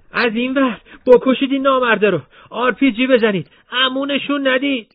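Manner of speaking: high, loud shouted orders in the street; battle shout. Acted. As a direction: yell orders over the gunfire. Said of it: "فریاد مرتضی در کوچه پیچید". (فریاد بلند فرمانده در کوچه)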